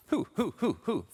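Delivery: high hooting owl. Pretend. Speaker hooting at the crowd like an owl. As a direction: hoot like an owl, high and light.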